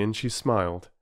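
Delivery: ordinary delivery, nothing marked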